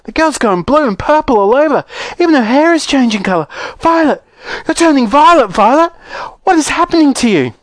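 high, frantic motherly hysteria; panicked yell. Acted. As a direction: cry out in mounting horror, pitched very high. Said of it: "yelled Missus Beauregard".

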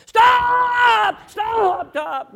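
high, very loud yell, easing off with each repeat; imitated frantic screaming. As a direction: scream to halt oncoming traffic. Two wild men halting traffic.

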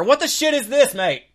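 startled shout. Acted. Doctor voice during checkup.

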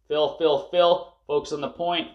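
usual speaking voice

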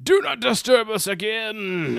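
high, slow, drawn-out warning; dramatic spirit voice. Acted. Spirit guides warn off Giles, dramatic. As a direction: intone it like an offended ghost. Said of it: "this really dramatic scene in Latin".

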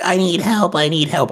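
high, mock cry for help; imitated plea. Man held down by police, mock high plea.

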